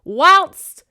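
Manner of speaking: high, loud one-word interjection; loud outburst. A narrator's reading. Balding Gordon cutting into the men's talk, loud. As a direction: burst out with it, pitch leaping. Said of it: "said Gordon".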